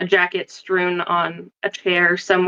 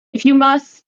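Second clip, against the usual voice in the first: higher, louder, put-upon sigh of a reply; weary reluctance; 6 semitones higher, 5 dB louder than usual. Acted. Professor reluctantly answers the dean's knock, exasperated. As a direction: grudgingly let him in with a sigh. Sighing at the dean.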